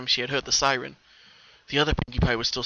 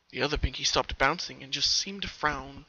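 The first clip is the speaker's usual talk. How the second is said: ordinary delivery, nothing marked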